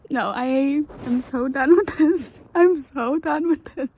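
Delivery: high, loud, crying complaint; tearful protest. Real, not acted. Reader sobbing at the book's ending, high and loud. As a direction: cry it out, fed up and heartbroken.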